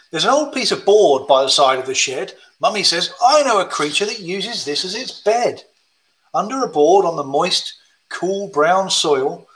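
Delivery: sing-song rhyming read; reading to children. Acted. Reads slow worm rhyme.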